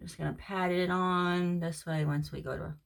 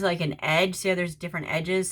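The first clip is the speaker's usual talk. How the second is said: ordinary delivery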